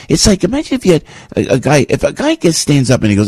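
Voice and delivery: ordinary talk, nothing marked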